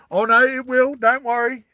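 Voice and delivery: high, loud playful insistence; excited reassurance. Real, not acted. GM reassuring players the grappling hook will matter, loud and high. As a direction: promise the players it will happen.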